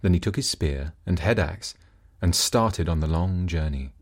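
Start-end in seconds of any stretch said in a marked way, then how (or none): none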